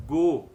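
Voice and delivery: Bo said loud and clear; syllable demo. Shown as a demonstration. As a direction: call out Bo.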